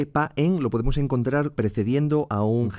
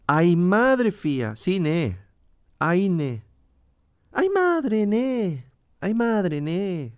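"Ay, madre" sung out high, then "ne" falling; Asturian exclamatory intonation; 6 semitones higher, much slower than usual. Shown as a demonstration. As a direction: stretch "Ay, madre" high and let it slide down. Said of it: "refuerzan o adornan la entonación del asturiano". («Ay, madre, ne» agudo, con cadencia asturiana)

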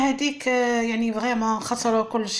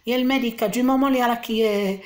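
ordinary talk, nothing marked

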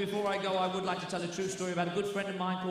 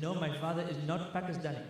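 lower, put-on Pakistani accent; joke-telling Pakistani accent, a little softer; 4 semitones lower, 4 dB quieter than usual. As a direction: answer in a mock Pakistani accent. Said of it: "you're talking with a Pakistani accent".

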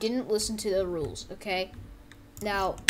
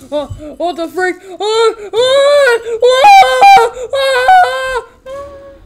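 high, very loud rising screams; acted agony; 20 dB louder, much slower than usual. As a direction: scream like someone being stabbed. Acting out a stabbed victim.

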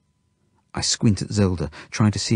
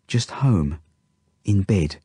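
ordinary delivery

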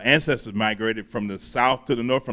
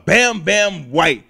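hype intro; 5 semitones higher, 5 dB louder, much slower than usual. Acted. Host hyping guest's stage name.

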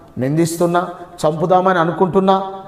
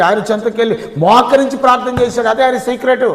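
higher, louder sermon at full force; fervent preaching; 5 semitones higher, 4 dB louder than usual. Acted. Pastor rousing the congregation about David's secret, fervent preaching. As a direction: proclaim it to the congregation.

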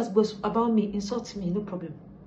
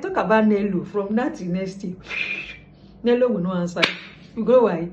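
ordinary delivery

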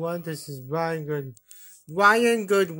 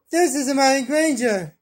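high, loud proud announcement; excited announcement; 6 semitones higher, 5 dB louder than usual. Pretend. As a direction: present her like a herald. Announcing characters in play.

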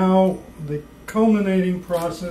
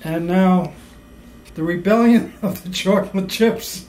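mock announcer; 6 dB louder than usual. Grandly announcing a mock title.

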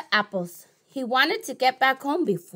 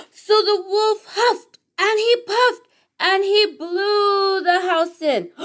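dramatic build; 8 semitones higher, slower than usual. A narrator's reading.

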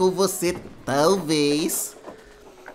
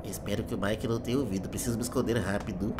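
bem baixinho e tenso; sussurrando; 6 semitones lower, 11 dB quieter than usual. Real, not acted. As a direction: fala baixo para não ser ouvido. Escondido no jogo.

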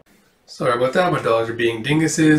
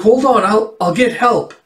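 urgent; 8 semitones higher, 4 dB louder than usual. Acted. Girl urgently offers the wizard help.

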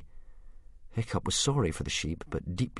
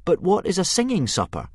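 put-on boy's voice; 11 semitones higher, 4 dB louder than usual. Hiccup voiced by narrator.

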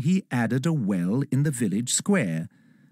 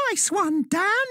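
cried out. Acted. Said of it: "cried the elf".

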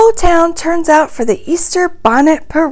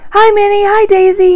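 higher-pitched friendly call; 7 semitones higher, 4 dB louder than usual. A narrator's reading. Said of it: "he calls".

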